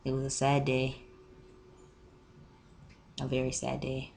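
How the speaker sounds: quiet, deflated narrator with long pause; mock-solemn. Storyteller closing the seat saga, mock-solemn.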